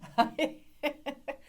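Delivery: high-pitched delighted laughter; amused laugh. Real, not acted. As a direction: burst out laughing at the class's answer. Amused that class has learned.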